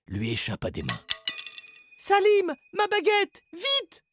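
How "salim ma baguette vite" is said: very high cry for help; urgent cry. A narrator's reading. Margot, tied up, calls Salim for her wand, urgent cry. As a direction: call out in urgent bursts. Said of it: "cria Margot".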